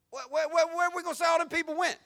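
high, fast, loud panicky voice of a scoffer; mock worried doubter. Preacher mocking scoffers in a high, panicky voice. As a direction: ape the doubters scrambling for an excuse.

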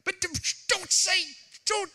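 put-on high, pleading little kid voice; child pleading. A pastor playing a child begging his dad, pleading. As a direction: beg like a scared child. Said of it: "sorry, Dad".

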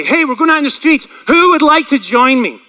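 loud, high call to a whole cafeteria; high-pitched rallying shout; screaming out. Retold or imitated. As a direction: shout an invitation across the room. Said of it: "I'll scream out to everybody".

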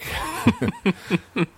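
high laugh burst. Real, not acted. Laughs mid outro.

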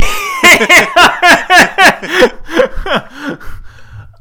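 high, loud burst of laughter. Real, not acted. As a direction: laugh hard at your own joke. Laughs at own whiny impression.

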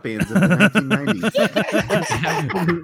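hyped, slow mock announcer read; clickbait video narrator. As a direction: oversell it like a listicle ad. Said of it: "He's like".

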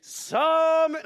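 loud, high, long-held call; Gud calling out. A narrator's reading. Storyteller as the voice waking Samuel, loud drawn-out call. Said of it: "Gud som ropte på Samuel".

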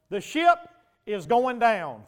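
ordinary delivery

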